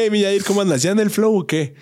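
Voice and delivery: lightly voiced own catchphrase; imitating the greeting. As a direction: ham up the friend's greeting. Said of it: "le dije".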